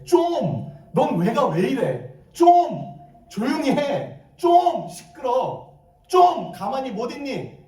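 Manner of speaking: high, exasperated Gyeongsang scolding; Gyeongsang dialect. Shown as a demonstration. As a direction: nag in Gyeongsang dialect, pushing the first word high. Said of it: "경상도 사투리로 한번 볼까요".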